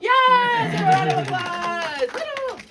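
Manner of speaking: high, loud game-show cheer; excited cheer. Real, not acted. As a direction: celebrate like a game-show host. Cheering a volunteer's answer.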